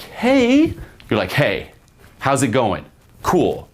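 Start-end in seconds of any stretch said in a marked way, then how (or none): 0.0-0.7 very high, eager, questioning greeting; rapport-seeking uptalk. Shown as a demonstration. Coach showing men the wrong greeting, rapport-seeking high pitch. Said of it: "Instead of being like". 1.3-3.7 cool, commanding greeting with falling endings; breaking rapport tonality. Shown as a demonstration. Coach showing how to greet a girl, breaking rapport. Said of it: "voice goes down at the end".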